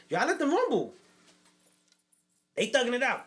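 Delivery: high and loud, thrilled; excited. Real, not acted. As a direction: tell it hyped up. Excited retelling the fight.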